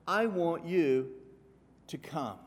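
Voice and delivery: God's servant calling guests, slow and weighty; slow emphatic call. Acted. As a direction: plead it slowly, leaning hard on each word.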